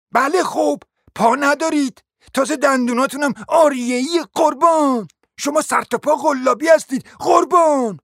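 high, indignant scolding; affronted lady. Acted. Noblewoman attacking the prince for insulting her, scolding indignantly.

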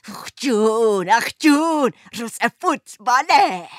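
slow, sarcastic mock cheer; mock delight. Wife mocking husband's Sunday football excitement, sarcastic. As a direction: parody his glee with scorn.

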